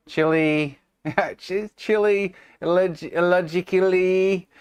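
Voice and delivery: high playful "Chili", "illogically" with a long "ee"; drawn-out E endings. Pretend. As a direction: push "Chili" and "illogically" up high, stretching the final "ee". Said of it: "We'll resume positions".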